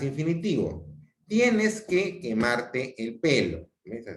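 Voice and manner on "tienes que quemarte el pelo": slow deliberate command; firm demonstration order; imperative. Shown as a demonstration. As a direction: spell out the order slowly. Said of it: "Hay una actitud imperativa".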